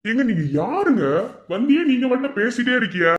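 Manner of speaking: exasperated, voice swooping up; indignant outburst. Acted. Challenging a stranger who keeps talking, indignant. Quarrel with a persistent follower.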